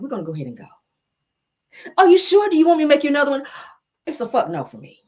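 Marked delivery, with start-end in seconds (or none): 2.0-3.4 high, loud sing-song server voice; perky, pitched-up service voice; mock waitress. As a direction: play the eager waitress offering a remake. Waitress offers another drink.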